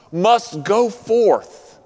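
"must go forth" drawn out very slowly, each word weighted; slow right down and lean on "go"; slow emphatic repeat. Acted. Preacher repeats the call emphatically.

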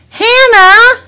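high, loud holler; calling out. Acted. Mom calling her daughter from camp, hollering. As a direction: holler for a child out of sight.

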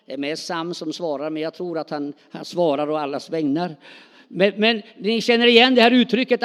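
normal talking voice

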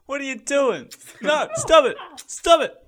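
high, loud squealing no, stop; squealing protest. Acted. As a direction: yelp while being tickled. Dinosaur being tickled, protesting.

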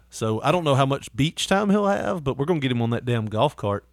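normal talking voice

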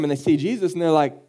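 usual speaking voice

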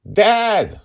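high, loud cry of protest; shouted. A narrator's reading. Daughter cries out to her father, high loud shout. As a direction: call out to stop him. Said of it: "she shouted".

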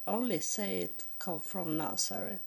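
normal talking voice

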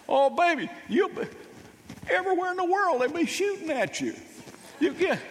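high, pleading mother's voice, hammed up; worried mom. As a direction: fret like an anxious mom losing her baby boy. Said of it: "Mom's response would be something like this".

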